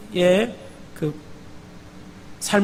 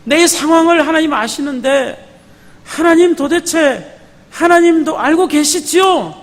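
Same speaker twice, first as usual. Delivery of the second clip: crying out to God; 6 semitones higher, 3 dB louder than usual. Retold or imitated. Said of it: "부르짖고 있습니다".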